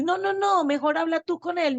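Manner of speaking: pleading refusal. Retold or imitated. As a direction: beg to be spared hearing God.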